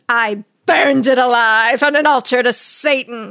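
loud, intense mock-possessed outburst; hammed-up demonic confession. Possessed girl confessing to the exorcist, hammed-up demonic outburst.

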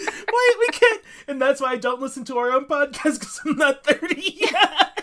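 high, loud, laughing through words. Real, not acted. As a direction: laugh while you protest. Cracking up at the joke.

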